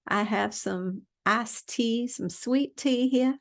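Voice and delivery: a playful, hammed-up Southern drawl over sweet tea; Southern accent. Vlogger greeting her viewers as a Southern belle, in a slow Southern accent. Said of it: "sound like I'm from the South".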